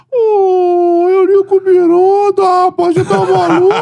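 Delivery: very high, slow, laughing mock coach voice; high slow coach impression; Jair exclaiming. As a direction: drag out the name in disbelief, pitched high.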